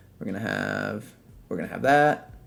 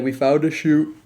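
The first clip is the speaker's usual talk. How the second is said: talking through a yawn; words stretched by a yawn; 5 semitones higher, 4 dB louder than usual. Real, not acted. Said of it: "sorry about the yawning".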